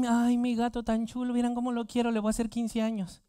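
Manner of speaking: high, gushing cat-lover voice; mock doting cat owner. Preacher imitating someone doting on a cat, mock-gushing high voice. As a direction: coo over the cat in a high, put-on voice. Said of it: "muchos van a decir".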